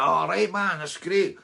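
loud, high, cheery Scottish greeting; Scottish accent. Retold or imitated. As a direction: switch into a broad Scottish hello. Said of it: "Completely switch of character".

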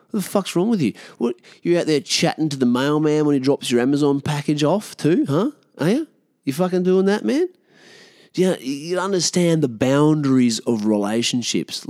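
mock-outraged interrogation; incredulous rant. Mock-berating the listener.

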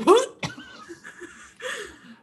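mock gagging through the words, laughing; pretend dry heaving. Hosts fake dry heaving to each other, mock retching. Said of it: "listening to us pretend dry heave".

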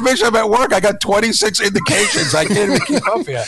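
high, worked-up protest with a laugh in it; retold exasperated yelling. Pleading to Mish about being swamped at work, mock-exasperated yelling. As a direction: re-enact an overwhelmed, high-pitched mock rant. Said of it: "thought I was yelling at you".

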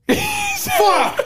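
high laughing outburst of dismay; frustrated shout. Real, not acted. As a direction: yelp at your own mistake. Realizing a wrong guess.

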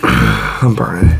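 low, slow pained groan; strained low groan. Real, not acted. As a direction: groan through a burning throat. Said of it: "Back of my throat".

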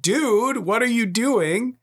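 high, drawn-out incredulous question; mock-exasperated dad voice. Dad re-enacting what he just said.

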